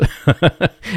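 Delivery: high, bubbling laugh; real laughter. Real, not acted. Laughing at the friend's doubt.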